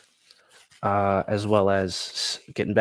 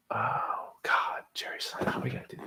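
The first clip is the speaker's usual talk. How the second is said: whispered; 3 semitones higher, 7 dB quieter than usual. Real, not acted. Whispered fake cry.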